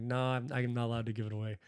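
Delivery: usual speaking voice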